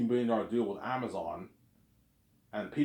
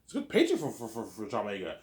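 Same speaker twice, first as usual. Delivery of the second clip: ordinary talk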